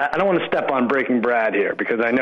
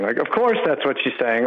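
normal talking voice

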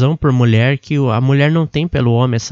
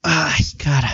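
stretching groan. Real, not acted. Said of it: "Deixa eu me espreguiçar".

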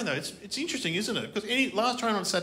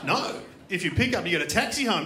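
ordinary talk, nothing marked

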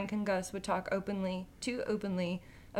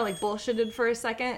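usual speaking voice

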